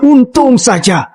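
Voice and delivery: high, excited cry of relief; relieved exclaim. Acted. Relieved repetition.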